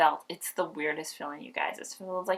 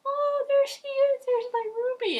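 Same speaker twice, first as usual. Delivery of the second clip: tender joy; 5 dB louder than usual. Retold or imitated. Mother meets her newborn, high and tender. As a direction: greet the baby with love. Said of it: "and I'm like".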